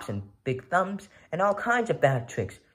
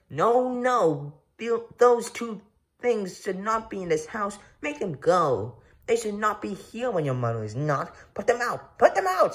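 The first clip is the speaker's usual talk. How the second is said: panicked fish; 7 semitones higher than usual. Acted. Fish in the pot begging the children, rising to a shriek.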